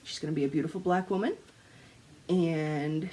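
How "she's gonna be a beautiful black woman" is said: ordinary talk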